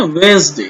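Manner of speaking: वेडनेसडे said wens-day, d dropped; silent d. Shown as a demonstration. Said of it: "डी को silent करना है". (वेडनेसडे में 'डी' silent, वेंसडे)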